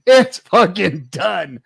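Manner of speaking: slow, drawn-out gloating. Real, not acted. Host gloating that the rival is finished, slow. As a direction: savor every word.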